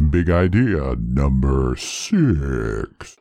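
very low, slow announcer voice; deep voice. Acted. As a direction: boom it out slowly, deep. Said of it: "Nice job, voice".

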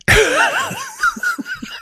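shrieking giggle way up high; high squealing laugh. Real, not acted. Laughing at own joke.

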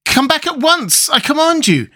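very high, alarmed order; frightened command. A narrator's reading. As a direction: order it back in a panicky high pitch. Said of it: "cried Tip in a frightened voice".

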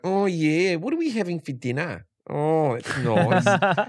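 lightly imitated bogan Australian drawl, slow at the end. Husband imitating wife's bogan Australian voice around the house. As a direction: give a hint of the wife's ocker Aussie. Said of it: "a bogan Australian voice".

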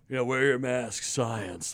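low, mock-authoritative voice; lightly mocking imitation of scolds; mocking quote. Mimics smug Twitter users.

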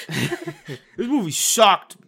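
slow, loud, laughing review voice; mock Google review. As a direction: drag out a laughing bad review. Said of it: "what Google reviews actually sound like".

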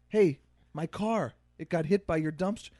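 mild Scottish complaint; light Scottish accent. Retold or imitated.

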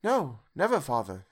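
earnest cry starting high, then falling; children promising their father emphatically. A narrator's reading. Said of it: "they all cried again".

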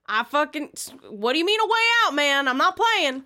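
high, loud, heated challenge; acted furious demand; angry threat. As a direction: interrogate aggressively, losing patience. Player threatens the cowerer.

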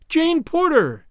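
high, delighted surprise at a name; astonished exclamation. A narrator's reading. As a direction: exclaim it high, dropping at the end. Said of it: "exclaimed the girl".

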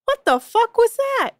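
high, slow, incredulous; stunned what-was-that; shocked. Real, not acted. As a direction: react in disbelief, high and drawn out. Shocked reaction to weird quote.

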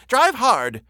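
high, loud shout to the coachman. A narrator's reading. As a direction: yell it at the driver from the cab. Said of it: "continued to shout all the time to the coachman".

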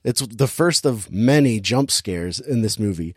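ordinary talk, nothing marked

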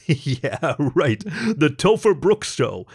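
scoffing laugh, drawn-out show title; chuckle, then announce the title slowly and high. A narrator's reading. Topher dismissing the idea of his own show, laughing.

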